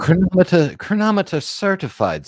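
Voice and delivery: high, grand announcement of chronometer; grand watch-talk voice. Pretend. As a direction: show off the watch jargon. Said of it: "See, I speak your language".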